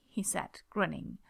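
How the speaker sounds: usual speaking voice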